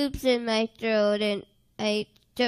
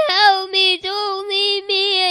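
tearful plea; 5 semitones higher, 4 dB louder than usual. Retold or imitated. Crying out to Jesus.

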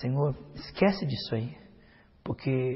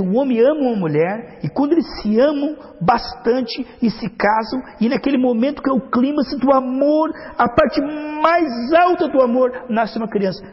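building to a climax; 7 semitones higher, 7 dB louder than usual. Real, not acted. Preacher swelling to the climax of love, loud and high.

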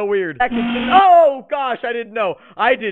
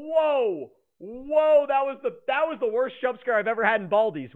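normal talking voice

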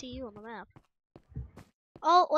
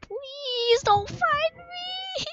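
pleading; 12 semitones higher, much slower than usual. Real, not acted. Hiding, pleading to seeker.

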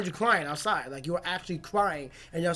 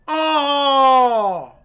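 pained outcry; 6 semitones higher, 14 dB louder than usual. Real, not acted. Hungry children scene.

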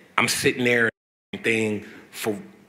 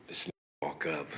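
whisper; 3 semitones lower, 10 dB quieter, much faster than usual. Retold or imitated. Hushed story of waiting.

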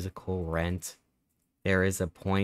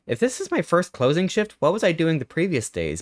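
higher and louder, baffled disbelief; baffled gamer reacting to odd dialogue, higher and louder; incredulous; 9 semitones higher, 4 dB louder than usual. Real, not acted. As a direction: question the logic out loud, amazed.

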